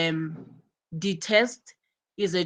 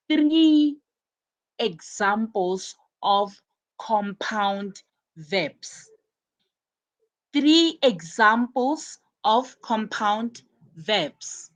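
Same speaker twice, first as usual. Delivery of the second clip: slow, spaced-out words with pauses; slow dictation; slower than usual. Real, not acted. Teacher giving students the compound verbs assignment, slow dictation. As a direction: dictate it slowly, phrase by phrase.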